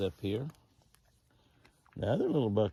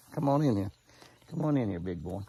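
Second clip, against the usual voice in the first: coaxing call to deer; 4 dB louder than usual. Real, not acted. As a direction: call him in gently but clearly. Coaxing buck closer.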